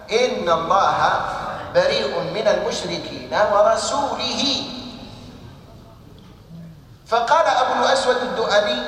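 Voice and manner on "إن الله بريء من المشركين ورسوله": slow recitation ending ورسوله in -ihi; ورسوله wrongly read with an i vowel after the lam; misread case ending. Retold or imitated. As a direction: recite slowly, putting a kasra on ورسوله: wa-rasulihi. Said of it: "فإنهم يلحنون في كتاب الله".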